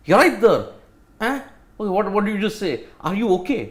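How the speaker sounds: a rapid mock northern English voice; Yorkshire accent. Mocking how fast northerners speak, rapid Yorkshire accent. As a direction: rattle it off like a Yorkshireman. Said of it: "rapidly, if they speak".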